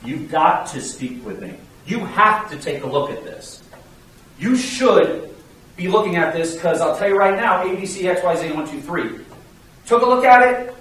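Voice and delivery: firm, assertive sales pitch, punching the key words; words of conviction. Shown as a demonstration. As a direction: sell it like you fully believe it. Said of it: "words of conviction".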